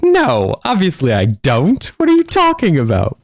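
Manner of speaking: high-pitched, incredulous refusal; mock indignant. Answering a sarcastic offer of dog poop, mock indignant. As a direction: play offended disbelief, pitch leaping up.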